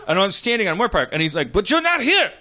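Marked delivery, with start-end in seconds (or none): 1.5-2.3 high, pushed mimicry of protest; insistent driver. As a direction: imitate a caller insisting, pitch climbing.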